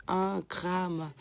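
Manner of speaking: ordinary delivery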